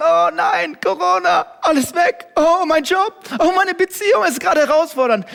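high, loud whiny wail of despair; mock panic lament. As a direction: wail it like everything is lost. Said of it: "Wir müssen umdrehen und sagen".

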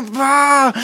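loud disgusted bah; frustrated shout; shouted bah of frustration. Acted. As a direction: blurt out an angry bah. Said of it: "dein Tag ist einfach nur".

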